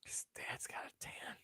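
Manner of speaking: hushed aside; whispering a cheeky remark. Real, not acted. Quiet aside while viewing photos.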